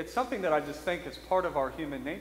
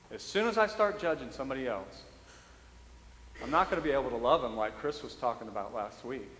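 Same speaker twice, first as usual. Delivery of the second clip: ordinary talk, nothing marked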